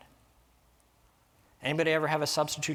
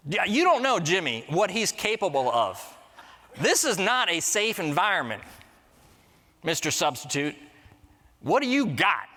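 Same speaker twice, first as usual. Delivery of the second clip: put-on cocky boys; 6 semitones higher than usual. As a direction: challenge the sub like a cheeky kid. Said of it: "Boys are like".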